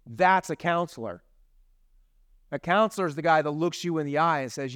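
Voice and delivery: ordinary talk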